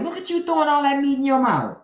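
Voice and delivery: high, teasing jab; mocking tease. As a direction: needle him playfully. Teasing husband about eating meat.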